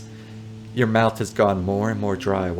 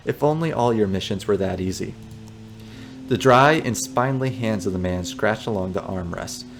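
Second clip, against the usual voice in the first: ordinary talk, nothing marked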